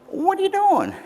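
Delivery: very high frantic frog voice; panicky frog. Acted. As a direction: squeal from inside the pocket. Frog protests.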